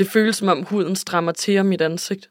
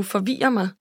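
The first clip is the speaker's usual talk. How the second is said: ordinary delivery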